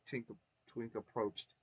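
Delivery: ordinary delivery, nothing marked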